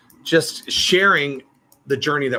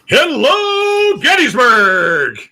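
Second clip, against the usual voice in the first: huge high shout, long drawn out; rock-star stage yell, vowels stretched; rousing crowd greeting; 12 semitones higher, 10 dB louder, much slower than usual. Acted. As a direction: bellow it to a whole arena. Acts out greeting a convention crowd.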